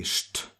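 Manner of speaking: sht with a hushing sh before the t; sht sound. Shown as a demonstration. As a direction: hush into the t, sht. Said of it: "It's like actually".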